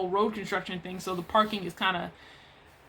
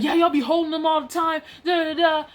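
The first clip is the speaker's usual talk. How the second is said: imitating a worked-up coworker, higher pitched; mock angry shouting; 6 semitones higher than usual. Imitating the angry coworker arguing back.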